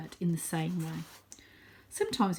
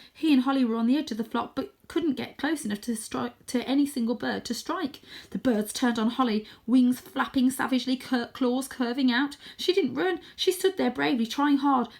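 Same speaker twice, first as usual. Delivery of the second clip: tense; 6 semitones higher, 4 dB louder than usual. A narrator's reading. Narration tense in the fight.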